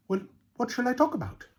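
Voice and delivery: high, fast, eager little rabbit. Acted. Proud little rabbit asks the animals what to say, high and eager. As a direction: bounce the question out, keen to please. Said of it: "he asked eagerly".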